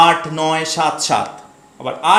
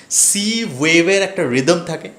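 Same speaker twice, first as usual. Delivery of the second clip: ordinary delivery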